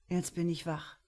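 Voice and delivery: ordinary delivery, nothing marked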